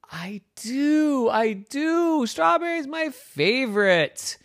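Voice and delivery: high-pitched, delighted squeal; gushing excitement. Acted. Comet says Strawberry is its favorite, squealing delight. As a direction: gush it high, drawing out each phrase.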